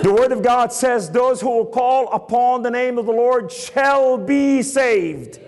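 high, sustained preacher's cry; ringing proclamation. Acted. Preacher proclaiming salvation to the church, ringing proclamation. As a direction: stretch the closing words into a climax.

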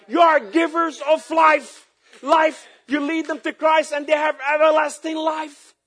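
high, loud pulpit exhortation; full-force preaching. Acted. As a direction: preach it out to the whole congregation.

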